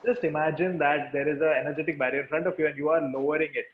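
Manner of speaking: ordinary talk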